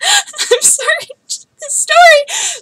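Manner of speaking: very high, slow, breaking into laughter and tears; laugh-crying. Real, not acted. Apologising to the audience while laughing through tears. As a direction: lose it mid-story, half laughing half crying.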